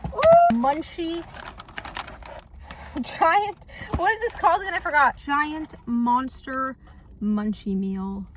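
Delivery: loud, slow, thrilled food reveal; excited. Real, not acted. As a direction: announce the meal with glee.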